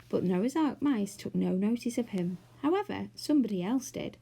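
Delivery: usual speaking voice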